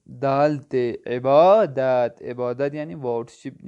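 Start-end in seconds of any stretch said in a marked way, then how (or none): 0.1-0.9 letters spelled out very slowly, one by one; teacher slowly spelling the letters of عبادت. Shown as a demonstration. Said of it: "عین بے الف دال تے". 1.1-2.2 عبادت drawn out slowly and a bit louder, e-baa-dat; teacher modelling عبادت after spelling it; slow model word. Shown as a demonstration. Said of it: "عین بے الف دال تے".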